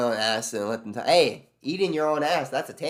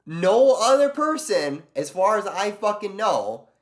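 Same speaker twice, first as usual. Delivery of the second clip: fired-up disbelief, slowing down; exasperated complaint; indignant outburst; 7 semitones higher, 5 dB louder, slower than usual. Real, not acted. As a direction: voice jumps high and loud, slowed. Indignant rant about unfair treatment.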